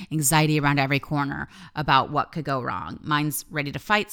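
normal talking voice

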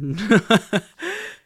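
high, loud burst of laughter; laughing at a friend's joke. Real, not acted.

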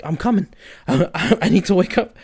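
loud, high, urgent calling out; retold panic. Stuck outside his body, shouting to wake himself up. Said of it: "And so I'm like".

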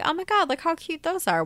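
high, excited gushing. Retold or imitated. Pointing out cute shoes to a friend, high excited gushing. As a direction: gush over something adorable. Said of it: "I was like".

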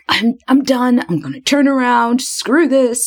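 high-pitched mock exasperation; fed-up outburst. Girlfriend ready to quit the uphill climb, fed-up mock rant. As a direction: act out giving up, pitched well up.

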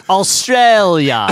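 drawn-out mock Australian accent; overdone Australian accent, stretched out; bad Australian accent. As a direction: imitate a friend's slow, proud Aussie accent. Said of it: "I do the best Australian accent".

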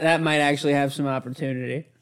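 normal talking voice

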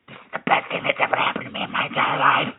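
breathless whisper of delight; awed whisper. Retold or imitated. Watching a chav fight on the escalator, awed whisper. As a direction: whisper it like a dream come true.

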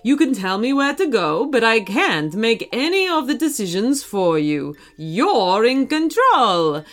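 encouraging cartoon hero, put-on voice; Mario. Mario puts Archie in control, hearty character voice. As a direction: coach him along in a lilting game-hero voice.

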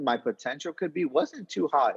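ordinary talk, nothing marked

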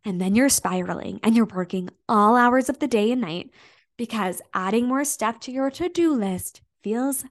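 usual speaking voice

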